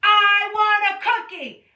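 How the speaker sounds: loud, high repeated demand; tantrum yell. Acted. Child having a tantrum in the park, loud high yell. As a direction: chant it like a stamping toddler. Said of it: "having a tantrum".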